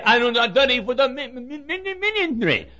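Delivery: high stammered mi- mi- ministry; mock stammer. Preacher mimicking Joe's stammer, high mock voice. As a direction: stumble on ministry like Joe. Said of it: "can't even talk plainly".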